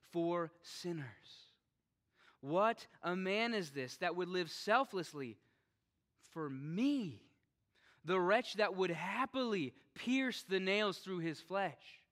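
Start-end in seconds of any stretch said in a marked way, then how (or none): 2.4-12.0 loud, slow pulpit exclamation; fervent preaching. Real, not acted. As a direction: swell with awe and fire. Preaching at full force.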